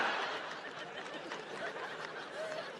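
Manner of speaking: breathy trailing cackle; mock laugh. Old man laughs at his uncle joke, breathy cackle. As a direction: laugh away at your own joke.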